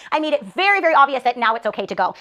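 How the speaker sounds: ordinary delivery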